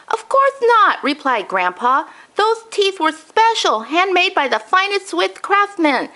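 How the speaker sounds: put-on high old man's voice, grave and upset; a grandfather announcing a tragedy; distressed grandpa. Grandpa's voice in the story.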